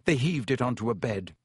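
usual speaking voice

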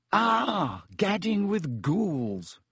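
slow, high preening drawl; Lockhart voice. Acted. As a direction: savour the title vainly.

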